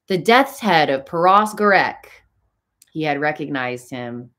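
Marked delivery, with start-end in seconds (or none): 0.1-2.1 raised, louder cry of recognition; startled exclamation. A narrator's reading. Raoul recognizing the masked man, exclaims. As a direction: blurt it out in shock. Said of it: "he nearly exclaimed".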